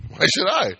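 high laughing question. Real, not acted. Preacher laughing at rude bar customers, voice up.

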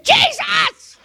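very high, loud cry; desperate cry. Retold or imitated. Calling on Jesus in her mafia pickle, loud high cry. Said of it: "So I said".

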